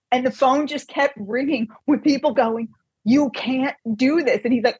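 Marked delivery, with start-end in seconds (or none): 3.1-4.4 slow, higher-pitched mock reproach; retold indignant protest. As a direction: draw out the imitated callers' objection. Retold callers protesting down phone.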